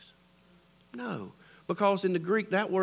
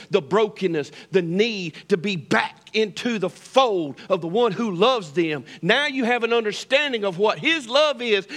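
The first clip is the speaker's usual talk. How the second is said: loud, driving sermon cadence; louder full-force pulpit delivery; fervent preaching; 6 dB louder than usual. Real, not acted. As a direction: preach it to the back row.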